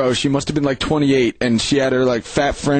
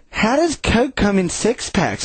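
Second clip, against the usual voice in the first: slow, higher clueless question; imitating her voice; 5 semitones higher, slower than usual. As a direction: ask it blankly, dragging it out. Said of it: "he's imitating her voice perfectly".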